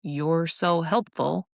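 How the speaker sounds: slow, drawn-out sarcastic praise. Shown as a demonstration. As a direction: stretch SO and mean the opposite. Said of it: "here's why sarcasm".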